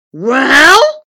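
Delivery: loud rising one-word question; booming giant. A narrator's reading. Giant wizard awaits his visitor's verdict, booming. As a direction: boom it upward, expecting praise.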